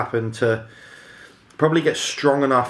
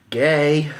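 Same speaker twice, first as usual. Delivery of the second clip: sneering one-word scoff; dismissive mocking jab; 4 semitones higher, 5 dB louder than usual. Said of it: "that sound in my head".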